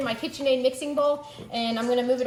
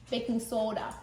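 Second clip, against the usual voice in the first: low, soft Minnesota accent; 3 semitones lower, 5 dB quieter than usual. Shown as a demonstration. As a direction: put on a playful Minnesota accent. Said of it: "say it like you're from Minnesota".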